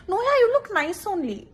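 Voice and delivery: high, breezy fake reassurance; mock friend reassurance. Friend lying about an ugly photo, high fake sweetness. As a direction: fob them off sweetly, high pitched. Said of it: "you'll be like".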